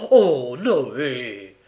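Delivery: loud, drawn-out reproach; reproachful. Acted. Charlotte hearing Noé blame her, reproachful. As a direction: cry his name as a scolding. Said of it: "s'écria Charlotte d'un ton de reproche".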